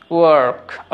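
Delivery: ওয়ার্ক with a full r; American pronunciation. Shown as a demonstration. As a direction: hold the r of ওয়ার্ক into the k. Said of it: "American".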